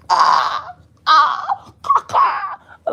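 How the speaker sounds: screeching bird cry, very high; high caw caw bird imitation; peacock call. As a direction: squawk like a showing-off peacock. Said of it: "like a male peacock".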